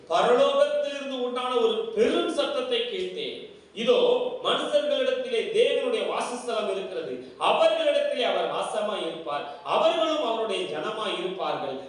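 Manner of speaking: high, ringing declaration of scripture; scripture proclamation. Real, not acted. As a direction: proclaim the verse to the whole congregation.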